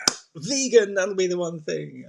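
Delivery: hammed-up excitement; mock eureka. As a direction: play it as a big discovery.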